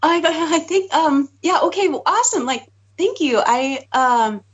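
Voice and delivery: nervous, tongue-tied thank you; awkward fumbling. Retold or imitated. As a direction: stumble through it like you're caught off guard. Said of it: "I did that awkward".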